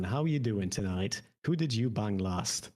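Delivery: ordinary delivery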